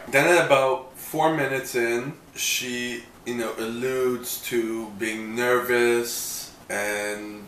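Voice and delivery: ordinary delivery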